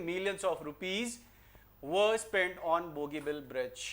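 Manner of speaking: very slow reading of the corrected sentence. Shown as a demonstration. Teacher to class, reading the corrected sentence slowly. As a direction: read the fixed answer out clearly. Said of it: "क्या बोलेंगे?".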